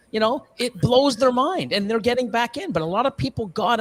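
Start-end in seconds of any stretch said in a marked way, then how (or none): none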